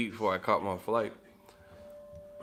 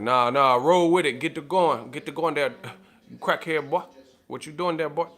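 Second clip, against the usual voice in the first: imitating a Southern manager chasing out a crackhead; 4 semitones higher, 4 dB louder than usual. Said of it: "you know what I'm talking about".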